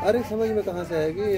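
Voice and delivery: ordinary talk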